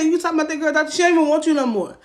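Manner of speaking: high, loud, fast mocking taunt; loud jab about the doctor girl; retold taunt. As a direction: throw it in his face. Mocking his new girl.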